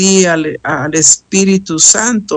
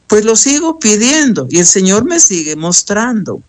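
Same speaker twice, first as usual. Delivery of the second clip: ordinary talk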